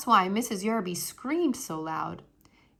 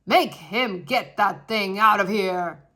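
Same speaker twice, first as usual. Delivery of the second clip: loud, slow commanding voice; loud stern old man; angry guest; 10 dB louder, slower than usual. Acted. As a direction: order the child removed. Mister Yarby furious.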